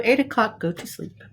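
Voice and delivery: plain reading voice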